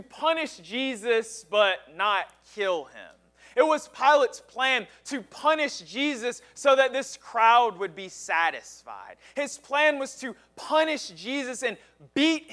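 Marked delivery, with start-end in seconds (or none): none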